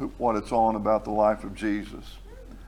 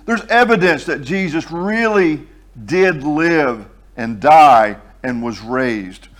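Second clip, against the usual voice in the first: slow, louder preaching, every phrase pressed; full-force preaching; 6 dB louder than usual. Acted. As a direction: proclaim it to the congregation, slowly. Emphatic preaching passage.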